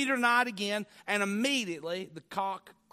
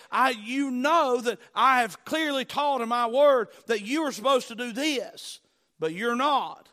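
loud, raised preacher's rebuke; stern conviction; 5 semitones higher, 8 dB louder than usual. Retold or imitated. God convicting a believer who disobeys his word, stern rebuke. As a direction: press the rebuke home forcefully.

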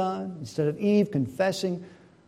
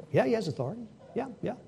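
soft, cowed reply; acted timid yes-man; meek agreement; 4 semitones higher, 7 dB quieter, much faster than usual. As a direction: give in quietly under pressure. Cowed Naboth answering Jezebel, acted.

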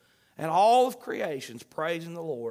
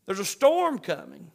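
ordinary talk

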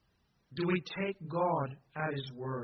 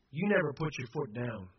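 ordinary delivery

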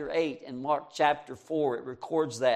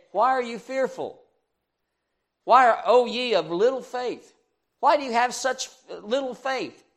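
Jesus rebuking; 7 semitones higher, 4 dB louder than usual. Acted. Jesus voiced rebuking disciples.

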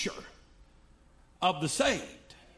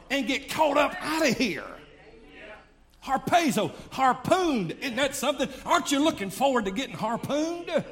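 louder, exultant preaching of "harpooned"; fervent preaching climax; 5 semitones higher, 3 dB louder than usual. Acted. Preacher exults to the congregation about the rapture, ringing and joyful. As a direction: lift them up with joy about being "harpooned".

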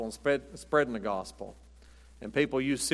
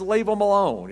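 mock demand; 7 semitones higher, 6 dB louder than usual. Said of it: "I say".

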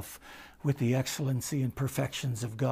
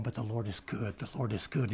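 hushed reassurance; 4 semitones lower, 10 dB quieter than usual. Retold or imitated. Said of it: "I just walked away going".

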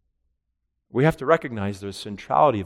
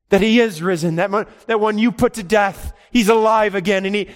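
pitched higher and louder, almost sung out; rising proclamation; 9 semitones higher, 5 dB louder than usual. Acted. Preacher to congregation, soaring higher and louder. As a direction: proclaim it like a triumph.

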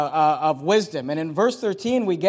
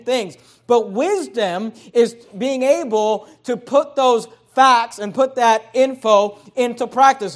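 raised, louder sermon voice; forceful preacher, pitched up and punching key words; emphatic preaching; 4 semitones higher, 5 dB louder than usual. Acted. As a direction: drive the teaching home from the pulpit.

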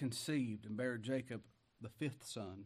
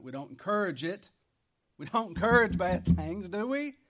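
rising to a shout; 5 semitones higher, 8 dB louder than usual. Real, not acted. Preacher building to a question.

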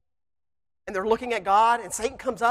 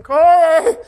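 loud call across the yard; yelling a name; 7 semitones higher, 12 dB louder than usual. Retold or imitated. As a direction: shout for your friend from up a tree. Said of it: "yelling my friend's name".

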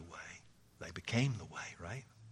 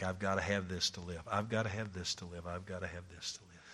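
softer and faster each repeat; fading mock craving; 5 semitones lower, 9 dB quieter, faster than usual. Preacher voicing people's cravings. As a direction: let the craving fade away.

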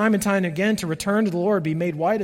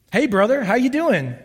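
cheerful greeting; 6 semitones higher, 5 dB louder than usual. Retold or imitated. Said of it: "I don't like this exchange".

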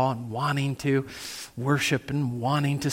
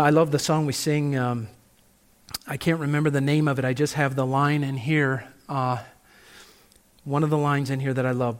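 ordinary delivery

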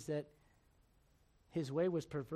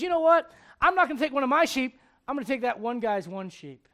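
higher and much louder gloating; loud greedy boast; 7 semitones higher, 13 dB louder than usual. Retold or imitated. Rich man to his guest, loud greedy boast. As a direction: crow it out selfishly.

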